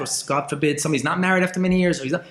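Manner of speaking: usual speaking voice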